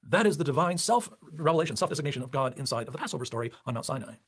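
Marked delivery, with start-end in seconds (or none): none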